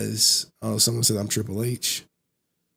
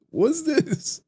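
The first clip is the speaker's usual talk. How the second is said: put-on surprised kid. Kid finding out Santa fake.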